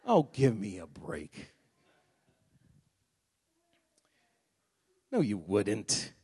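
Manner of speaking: low, soft, slow dismissive scoff; scoffing disbelief. Acted. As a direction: brush off the claim with a knowing scoff. Pastor scoffs at tithing claim.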